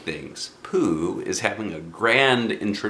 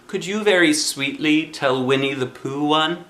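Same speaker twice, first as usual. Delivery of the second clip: put-on coaxing child; 6 semitones higher than usual. Child voice asking sweetly.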